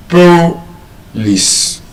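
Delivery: Police as POH-lis, broken after the first syllable; high POH in Police, then a short lis; first-syllable stress. Shown as a demonstration. Teacher showing the rule misapplied, Police stressed on POH. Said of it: "if we stress it on the first syllable".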